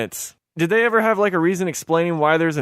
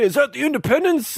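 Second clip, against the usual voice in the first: mimicking Bruce Willis; 9 semitones higher than usual. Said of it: "doesn't he also say".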